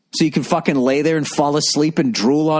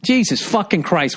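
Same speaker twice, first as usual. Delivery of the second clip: normal talking voice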